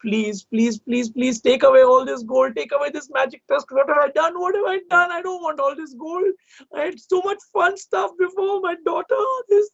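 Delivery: remorseful king pleading. A narrator's reading. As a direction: plead to take it back. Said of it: "He was like".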